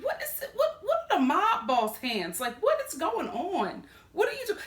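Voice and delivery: very high, incredulous, laughing questions; mock bewildered. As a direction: poke fun at his pose. Mocking Jason's mob boss pose.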